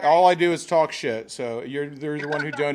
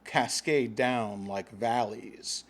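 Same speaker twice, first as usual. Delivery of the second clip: mock epic narration; 3 semitones lower, 5 dB quieter, slower than usual. Mock grand tale of fate.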